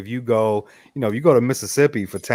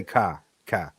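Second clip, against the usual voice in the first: put-on old Boston car; 7 semitones lower, 9 dB quieter than usual. Shown as a demonstration. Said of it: "I used to definitely say".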